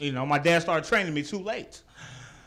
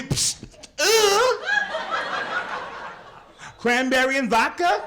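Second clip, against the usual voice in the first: high, sassy mock voice of a girl at the club; mock girl's drink order; 10 semitones higher, slower than usual. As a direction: squeak it out like a girl angling for a drink.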